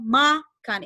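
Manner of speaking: MA-kan, stress on the first syllable. Shown as a demonstration. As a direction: hit ma in makan. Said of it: "you stress the ma".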